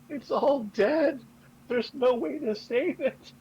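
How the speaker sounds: high, slow wailing sob; sobbing. Acted. Daishin sobbing over dead land, high wailing cry. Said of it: "is just sobbing".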